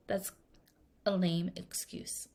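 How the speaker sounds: unvoiced whisper of a verdict; whispered aside. Real, not acted. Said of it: "whispered aside".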